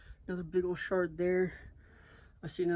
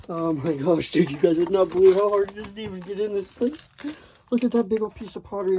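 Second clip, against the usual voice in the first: excited; 3 semitones higher, 10 dB louder than usual. Real, not acted. Struggling into cave, amazed.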